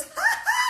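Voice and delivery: put-on high, loud old-woman cackle; hammed-up "ha ha" high in pitch; mock laugh. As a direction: burst out in a scoffing laugh. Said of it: "she starts to laugh".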